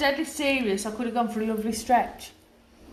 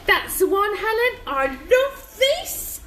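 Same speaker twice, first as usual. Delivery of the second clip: excited; 11 semitones higher, 4 dB louder than usual. Real, not acted. Excited reading viewer comment.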